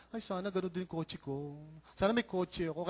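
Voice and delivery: softly voiced mock longing; wistful wishing. Pastor imitating someone who sees a passing car, quietly wistful. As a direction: wish for it under your voice.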